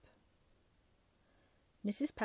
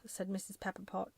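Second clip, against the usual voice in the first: ordinary delivery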